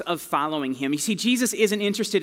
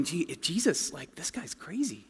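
much softer, nervous murmur; worried hush; 8 dB quieter than usual. Retold or imitated. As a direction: hush it like a dangerous secret. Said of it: "people are going".

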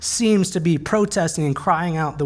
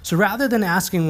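ordinary delivery

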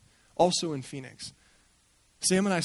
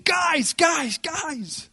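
high, loud shouted calls; retold scream; 12 semitones higher, 8 dB louder, much slower than usual. Man at the back of the church screaming to the two homeless guys. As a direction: yell across the room to grab their attention. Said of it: "we hear this guy just screaming".

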